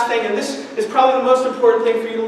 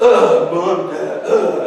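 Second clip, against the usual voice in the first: put-on sulky kids groaning at their parents; caveman noise; 5 semitones lower, 3 dB louder, much slower than usual. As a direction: grumble like a cave kid. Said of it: "we call it the caveman noise".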